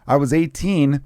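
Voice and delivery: normal talking voice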